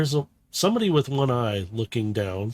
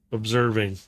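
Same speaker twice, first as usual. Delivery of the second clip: ordinary delivery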